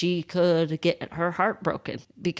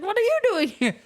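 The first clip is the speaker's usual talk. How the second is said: high, loud startled question; high shrieking surprise at the door; shocked squeal; 4 dB louder, faster than usual. Retold or imitated. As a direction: gasp it out as she spins round. She turns and sees him.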